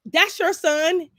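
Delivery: high, slow, loud sass; pointing out whose son he is; pointed retort. Retold or imitated. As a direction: stretch it out to make the point. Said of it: "And I'm like".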